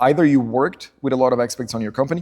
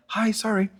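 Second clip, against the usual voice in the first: put-on girl's timid hello; 10 semitones higher, 5 dB quieter than usual. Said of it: "a girl comes and like".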